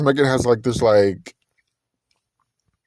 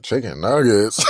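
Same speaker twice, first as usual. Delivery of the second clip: disbelieving echo; 2 semitones lower than usual. Retold or imitated. Guy reacts to her diet.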